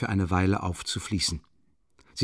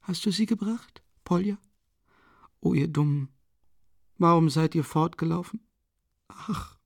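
high, frail voice of a dying mother; dying woman voice; 8 semitones higher than usual. A narrator's reading. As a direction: plead feebly with the children. Dying mother speaks in story.

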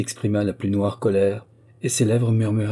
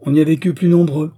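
much louder, higher curt retort; curtly; 8 semitones higher, 10 dB louder than usual. Acted. Servant snapping back at the prince, curtly. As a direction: bite the words off curtly. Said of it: "dit-elle sèchement".

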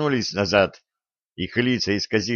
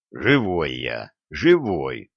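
low, slow, effortful reassurance; hoarse croak; 6 semitones lower, slower than usual. A narrator's reading. Injured Oleg assures the girl he lives, hoarsely. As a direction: croak it out through chest pain. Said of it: "прохрипел Олег".

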